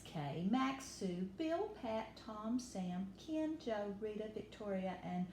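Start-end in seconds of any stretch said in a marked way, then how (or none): none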